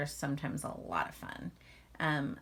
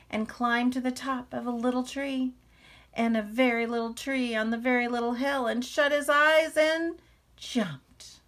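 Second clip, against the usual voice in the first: building suspense; 7 semitones higher, 7 dB louder than usual. A narrator's reading. Narration builds to the jump.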